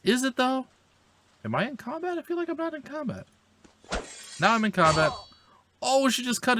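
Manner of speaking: a puzzled gamer whining at the game, high; high incredulous whine. Real, not acted. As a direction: squeak out your disbelief. Doubting the game's combat.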